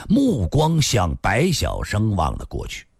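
ordinary delivery

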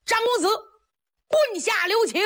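calling out high to stop the blow; urgent shout. A narrator's reading. Officer shouting to Zhang Ying to spare the fallen man, urgent cry. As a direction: shout it across the field to halt him. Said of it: "急忙高喊".

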